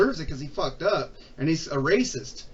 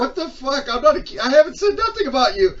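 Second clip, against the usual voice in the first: loud outrage; 6 semitones higher, 7 dB louder than usual. Retold or imitated. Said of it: "I go, I go".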